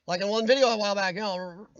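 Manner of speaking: ordinary talk, nothing marked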